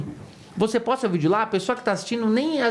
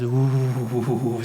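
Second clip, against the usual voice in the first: audience groaning; 8 semitones lower, much slower than usual. Retold or imitated. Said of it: "a plateia faz".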